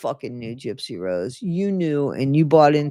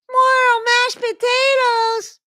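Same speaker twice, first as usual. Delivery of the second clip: much higher, slow, tiny squeaky voice; squeaky mock voice; 4 dB louder, much slower than usual. Mocking the book's squeaky voice story, tiny high squeak. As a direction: squeak it out like a put-upon child.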